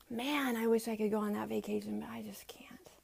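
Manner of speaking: whispered excuse. Pretend. Mom whispering an excuse about a vacation, whispered. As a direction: breathe it out in a whisper.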